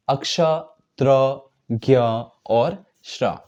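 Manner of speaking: अक्ष, त्र, ज्ञ, श्र said slowly and apart; slow careful recital of conjuncts; slow conjunct letters. Shown as a demonstration. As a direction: spell out each conjunct slowly. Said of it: "इन्हें हम संयुक्त व्यंजन कहते हैं".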